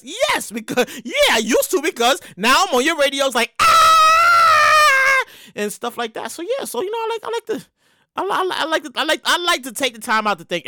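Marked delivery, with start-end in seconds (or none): 3.6-5.2 loud, high sustained scream; long high yell. Pretend. Host imagining himself on local radio, long mock scream. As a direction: hold one long high "Ah" at full voice.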